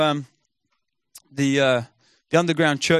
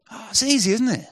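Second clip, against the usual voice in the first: high mock-breezy irony, tongue in cheek; pitched-up sarcastic aside; 9 semitones higher than usual. Real, not acted. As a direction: toss it off as if it were nothing, dripping irony. Ironic remark on ten months.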